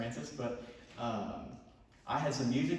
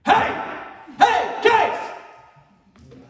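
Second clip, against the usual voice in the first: very loud, high holler; 14 dB louder, much slower than usual. Shown as a demonstration. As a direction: holler to someone over roaring machinery. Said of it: "if I need to holler at Case".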